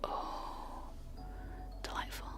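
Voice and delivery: ordinary talk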